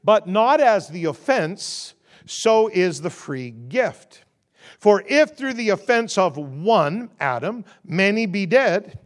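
slow, solemn reading of the verse; measured Bible reading to the congregation; scripture reading. Acted. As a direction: read it out like a lesson from the pulpit. Reads scripture aloud.

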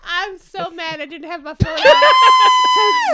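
loud slow laughing words. Real, not acted. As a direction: shout it through laughter. Laughing at story.

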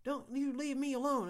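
high, loud mock rant from a voicemail; imitating a drug-fueled rant. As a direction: shriek it like the frantic caller, hammed up. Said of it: "drug-fueled rant was like".

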